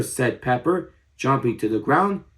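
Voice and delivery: ordinary delivery, nothing marked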